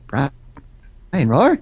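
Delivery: BRIAN ROAR punched out as a rising exclamation; BRIAN, a beat, then ROAR hit hard and rising; emphatic greeting. Retold or imitated. Imitating an old schoolmate's greeting, emphatic BRIAN ROAR. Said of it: "he would always say it all emphatically".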